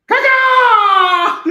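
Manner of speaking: high screeching squawk; shrieked caca. Pretend. Air Force joke punchline.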